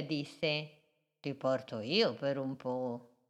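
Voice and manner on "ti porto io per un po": lower, softer turtle; calm offer of help. Acted. Turtle offers to carry the boat, low and soft.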